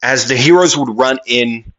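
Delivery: usual speaking voice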